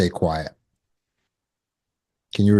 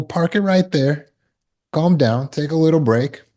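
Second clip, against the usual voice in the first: firm boundary; 7 semitones higher than usual. Shown as a demonstration. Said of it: "tell people".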